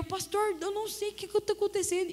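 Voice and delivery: high, agitated retold plea; aflita. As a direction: imitate a panicked call for help. Retold panicked call to the pastor. (voz aguda de aflição, imitada)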